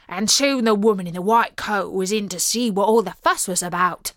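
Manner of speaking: ordinary delivery, nothing marked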